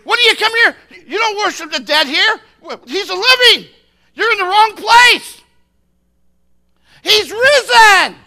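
high, full-force proclamation; shouting angel. Acted. Angel at the empty tomb, triumphant shouting. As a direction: shout the news at full power. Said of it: "and the angel said".

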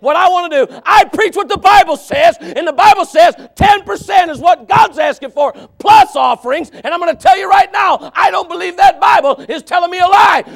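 loud, high, fiery preaching that climbs to shouts; preaching at full force. Acted. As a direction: preach it hard, pushing every line out to the congregation.

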